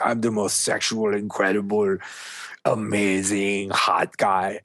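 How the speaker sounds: mock French accent, self-assured. Coach voicing the French dude's self-belief, mock French accent. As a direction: imitate a cocky Frenchman's accent. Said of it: "this thick French accent".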